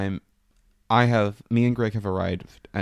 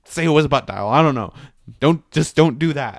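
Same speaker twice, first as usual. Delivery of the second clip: 1 louder urgent warning; 5 semitones higher, 8 dB louder than usual. Retold or imitated. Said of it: "She's like".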